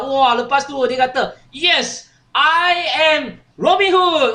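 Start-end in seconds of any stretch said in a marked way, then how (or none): 1.5-4.3 drawn-out, higher-pitched mock hero's cry; triumphant boast. Eldest prince boasting after hitting the apple, triumphant. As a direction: proclaim it slowly like a storybook champion.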